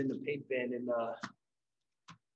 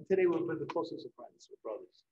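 usual speaking voice

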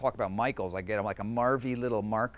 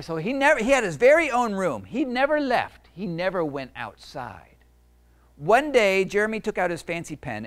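picture book reading; 5 semitones higher, 4 dB louder than usual. A narrator's reading. Author reading his book to kids, storytelling voice. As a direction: read it to a room of kids.

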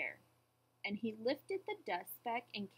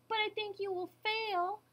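high, smug taunt; sneering bird; 11 semitones higher than usual. A narrator's reading. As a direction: gloat as a scornful bird. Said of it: "sneered the bird".

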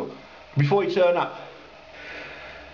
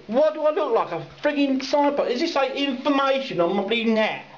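indignant; 8 semitones higher than usual. Real, not acted. Indignant rant about drivers.

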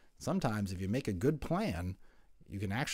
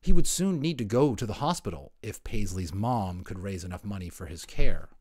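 ordinary delivery

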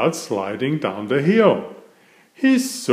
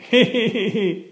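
laugh; 9 semitones higher than usual. A narrator's reading. Laughing at Daddy Pig.

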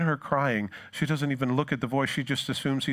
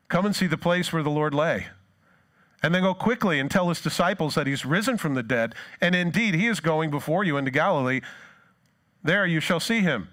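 raised, ringing angel's announcement; scripture proclamation; 4 semitones higher than usual. Acted. Angel sends the women to tell disciples, proclaiming. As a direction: proclaim it with lifted pitch and energy.

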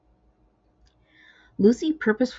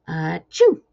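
sneeze; 8 dB louder than usual. A narrator's reading. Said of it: "Dude sneezed".

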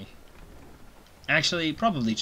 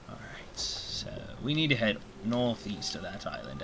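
whispered aside; hushed all right; 8 dB quieter than usual. Real, not acted. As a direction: drop to a breath of a voice.

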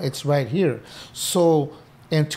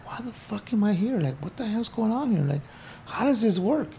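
higher, softer bewildered questioning; frustrated, searching for sense; exasperated wondering; 5 semitones higher, 5 dB quieter than usual. Retold or imitated. Inmate wondering daily why he is there, exasperated. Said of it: "every day I'm thinking".

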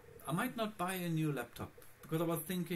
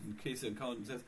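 usual speaking voice

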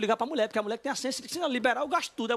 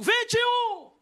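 very high, loud bid yell; acted all-out auction holler; retold shouting; 12 semitones higher, 4 dB louder, much slower than usual. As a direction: shout the bid over the whole room. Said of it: "Quem é que tá gritando aí".

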